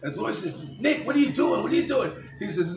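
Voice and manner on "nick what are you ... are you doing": loud, high alarmed cry; retold shocked call to Nick, much higher and louder; startled outburst, much higher and louder; alarmed plea. Retold alarmed question in court.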